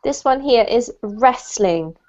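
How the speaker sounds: slow, careful "wrestling" for the student to copy; slow model word. Shown as a demonstration. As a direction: model "wrestling" slowly and clearly. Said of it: "Would you like to repeat it?".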